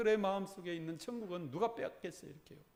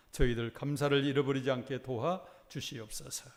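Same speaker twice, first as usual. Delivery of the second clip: low, reverent prayer voice; a pastor's lowered, earnest closing prayer; 5 semitones lower than usual. Real, not acted. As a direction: pray on behalf of the church, reverently.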